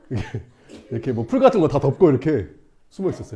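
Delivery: plain reading voice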